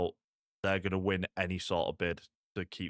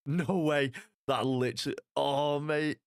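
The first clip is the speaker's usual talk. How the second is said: raised, exasperated outburst; excited disbelief; 6 semitones higher than usual. Real, not acted. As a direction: caught up in the moment. Reacting live to the play.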